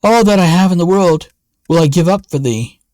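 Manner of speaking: high, fast, urgent promise; eager cry. A narrator's reading. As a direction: blurt out the vow in desperation. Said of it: "Then cried the king".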